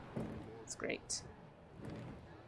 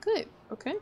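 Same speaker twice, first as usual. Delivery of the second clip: alarmed; 11 semitones higher, 4 dB louder than usual. Real, not acted. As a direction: squeak it out in real unease. Alarm at something ahead.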